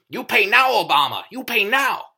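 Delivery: a hammed-up Chinese accent, barking the demand; mock Chinese accent. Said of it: "I did a Chinese voice".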